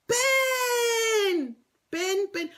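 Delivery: high excited shout of a name; squealing greeting shout; excited call. Real, not acted. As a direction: call his name out happily. Calling out excitedly to viewer.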